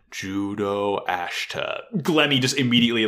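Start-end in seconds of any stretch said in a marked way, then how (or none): 0.1-1.8 drawn-out, softer slow-motion name. Acted. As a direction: stretch the name as if time slows. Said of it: "in slow motion".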